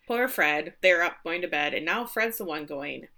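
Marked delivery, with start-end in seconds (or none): none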